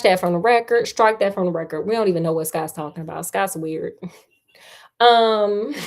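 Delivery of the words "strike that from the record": ordinary talk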